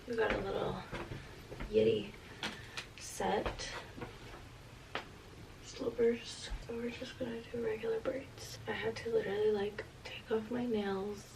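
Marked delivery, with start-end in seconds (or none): 0.1-8.6 soft unvoiced whisper. Real, not acted. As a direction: whisper it close to the mic. Quiet pre-surgery narration.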